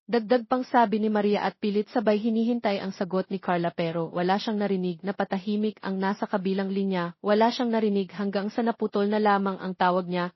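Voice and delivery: plain reading voice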